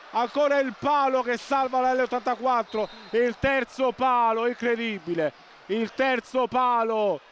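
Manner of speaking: high, loud, shouting excited commentary; breathless italian goal commentary. Real, not acted. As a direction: erupt as the shot hits the post. Post hit, commentator erupts.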